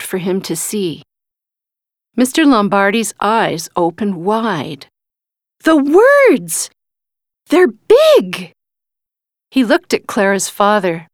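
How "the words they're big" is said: high, excited cry of discovery; thrilled astonishment; amazed exclamation. A narrator's reading. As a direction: exclaim in wide-eyed wonder, pitched way up. Amazed man sees enlarged words.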